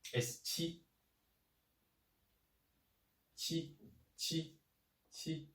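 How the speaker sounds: qi said high and level, first tone; Chinese qi. Shown as a demonstration. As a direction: hold qi high and flat, first tone. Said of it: "listen carefully".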